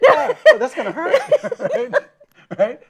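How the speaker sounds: laughing through the words, pitch rising high. Real, not acted. Host laughing at the guest's joke, laughing. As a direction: get the words out while still cracking up.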